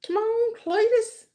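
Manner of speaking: high, slow teasing call; drawn-out taunt. Pretend. Repeated higher, slower taunt.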